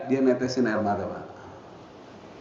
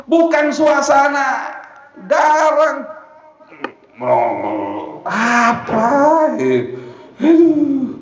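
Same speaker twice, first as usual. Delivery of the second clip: impassioned preaching; 9 semitones higher, slower than usual. Acted. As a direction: preach it warmly, raising the voice. Raised preaching voice.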